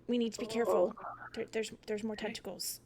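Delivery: hushed urgent whisper. Acted. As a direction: whisper the warning tensely. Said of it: "whispered warning".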